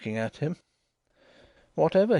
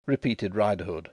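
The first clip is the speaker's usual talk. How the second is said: ordinary delivery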